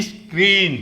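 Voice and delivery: 'is-kreen' for स्क्रीन, strong second syllable; leading 'is' sound. Shown as a demonstration. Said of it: "ई एस की वाली आवाज़ आ रही है".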